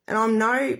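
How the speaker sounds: usual speaking voice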